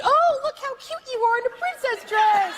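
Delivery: excited, very high gushing squeal; re-enacted high-pitched excitement; really high-pitched. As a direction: gush way up high at a cute little kid. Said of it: "I get really high pitched".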